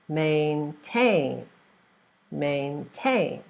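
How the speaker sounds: MAINTAIN with a long, strong TAIN; last-syllable stress. Shown as a demonstration. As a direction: lean on the second syllable of maintain. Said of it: "stress the last syllable".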